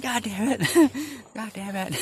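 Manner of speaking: high, loud playful curse breaking into laughter; gleeful high-pitched cussing; joyful cursing. Shown as a demonstration. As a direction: laugh through a mock-angry swear. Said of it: "a joyful expression on it".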